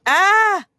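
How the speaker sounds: high, loud startled cry; a turkey's sudden alarmed yelp; loud high squawk of fright. Acted.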